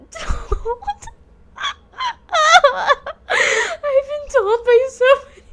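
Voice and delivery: high, loud words breaking into laughter; helpless laughing. Real, not acted. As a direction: try to get it out while cracking up. Laughing fit while boasting.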